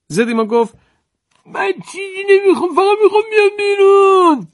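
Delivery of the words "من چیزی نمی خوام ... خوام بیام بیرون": high, loud nasal-free taunt from inside the jar; cranky old jar-mender. Acted. Old mender taunts the farmer from inside the jar, high mocking voice.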